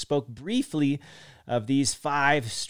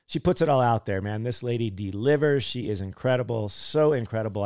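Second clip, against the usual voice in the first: ordinary delivery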